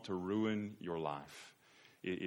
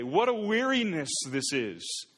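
a groaning, sarcastic complaint about worship; mock-weary whine, slowed down; weary mock sigh; 8 semitones higher, 8 dB louder, slower than usual. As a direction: drag it out, bored and put-upon. People mocking God's demands.

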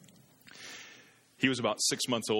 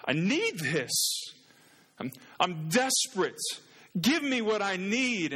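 desperate cry; 10 semitones higher, 3 dB louder, slower than usual. Retold or imitated. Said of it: "screaming to God".

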